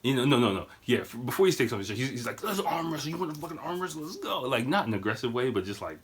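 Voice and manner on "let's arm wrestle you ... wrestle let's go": put-on high-pitched crazed drunk challenge; batshit crazy. Drunk grandfather challenging the manager, batshit crazy. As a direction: imitate a manic but friendly challenger. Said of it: "just going fucking batshit crazy".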